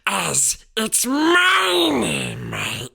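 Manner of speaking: high, loud, slow whining cry; cling to it like a greedy creature; possessive creature. A narrator's reading. Boy hissing over his precious letter like a creature. Harry clutching letter, Gollum-like.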